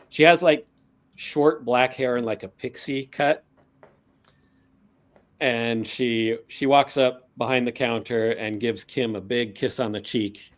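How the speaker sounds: ordinary delivery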